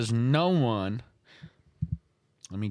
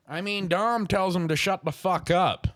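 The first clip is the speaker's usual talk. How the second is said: ordinary talk, nothing marked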